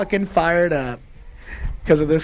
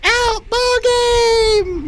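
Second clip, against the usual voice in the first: high, drawn-out, louder umpire call; umpire's call; 7 dB louder, much slower than usual. Retold or imitated. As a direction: sing out the umpire's call, stretched long. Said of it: "She goes".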